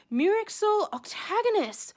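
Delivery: ordinary talk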